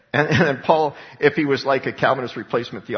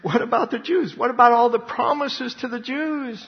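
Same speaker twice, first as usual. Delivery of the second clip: mock-incredulous questioner, pitched higher; lightly hammed-up high skeptical voice; incredulous objector; 8 semitones higher than usual. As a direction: play the doubter who can't believe it. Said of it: "so then people will say".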